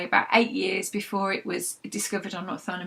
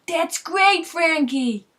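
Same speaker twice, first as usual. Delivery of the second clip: a higher, louder hint of Italian-American voice calling from the kitchen; Italian-American call-back; 8 semitones higher, 6 dB louder, much slower than usual. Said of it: "A thick, breathy Italian-American voice called back".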